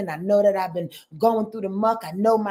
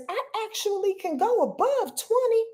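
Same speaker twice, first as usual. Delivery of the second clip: high excited realization; 11 semitones higher than usual. Pretend. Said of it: "the human says".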